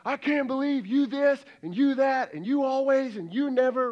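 loud, high accusing rant; mock heated argument. Spouse accusing spouse mid-fight, mock furious. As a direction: act out an escalating family fight. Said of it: "intensity, everything".

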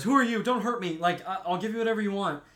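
scared yelp, then a fast plea; startled fear. Retold or imitated. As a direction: retell a frightened man begging an intruder. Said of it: "gets startled".

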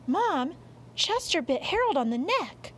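put-on high-pitched kid telling on the cat; alarmed boy. Son telling Mom about the bite, alarmed and high. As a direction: call out to Mom in a child's high voice.

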